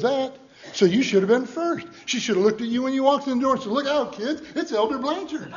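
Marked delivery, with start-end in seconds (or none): 3.7-5.5 mock grand announcement. Mock announcing his own importance.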